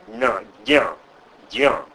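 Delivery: loud, separate "Na, Gya, Gya" syllables; rival pronunciations. Shown as a demonstration. Lecturer listing what some people say, rival pronunciations. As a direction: punch out "Na, Gya, Gya" one by one. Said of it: "Some people say".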